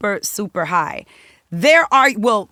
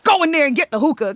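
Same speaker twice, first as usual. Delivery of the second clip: angry order; 7 semitones higher, 6 dB louder, faster than usual. Retold or imitated. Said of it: "This dude storms out the booth".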